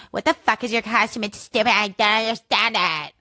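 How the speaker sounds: scornful, accusing put-down; accusatory sneer at a costume; judgy accusatory tone. Shown as a demonstration. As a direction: demand it like a judgy snob. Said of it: "this, like, judgy, accusatory tone of".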